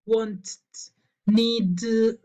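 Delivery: "want" and "need" with a doubled final t or d, no extra vowel; inaudible ED ending. Shown as a demonstration. Teacher shows why the rule fails, "want", "need" with doubled consonant. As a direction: say "want", "need" adding only a second t or d. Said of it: "le agrego esto, que sería la, seguir la regla".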